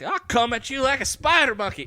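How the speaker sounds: high, loud kid impression; mock movie kid. Podcaster quoting the Ricky Bobby kids, high loud mock yell. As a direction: holler it like a wild little boy. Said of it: "the kids are like".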